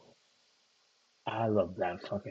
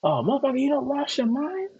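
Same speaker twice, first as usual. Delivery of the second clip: much higher, louder, hammed-up movie-hero shout; imitating an action hero; 6 dB louder than usual. Retelling Blade's scene to stream viewers, hammed-up high shout. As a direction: throw the line like the hero mid-fight. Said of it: "and he was like".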